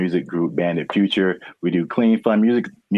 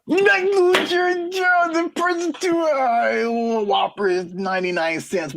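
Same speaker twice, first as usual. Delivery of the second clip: much higher, slow cartoonish squeak on the first words; a silly high-pitched caricature voice that mocks the warning; squeaky mock voice; slower than usual. Mocking voice echoing the warning.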